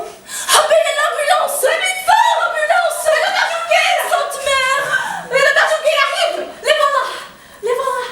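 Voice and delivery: very high, loud frantic cries; panicked screaming. Acted. Patient crying out for the ambulance and doctor, panicked screaming. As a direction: scream for help in mounting hysteria. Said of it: "J'ai crié".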